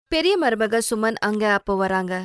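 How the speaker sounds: ordinary delivery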